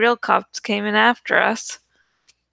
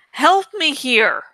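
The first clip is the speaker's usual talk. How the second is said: yelling; 5 semitones higher than usual. A narrator's reading. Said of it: "I yelled".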